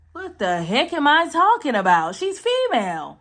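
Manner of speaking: loud, high-pitched self-scolding; exasperated outburst. Real, not acted. Reader botches the post's intro, exasperated outburst. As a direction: burst out high and loud in exasperation at your own slip.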